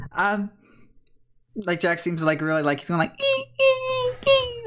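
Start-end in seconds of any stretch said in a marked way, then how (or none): 3.2-4.6 very high drawn-out squeaks; happy creature squeal. Acted. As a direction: squeal like a happy little Pokémon. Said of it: "he's happy being with the".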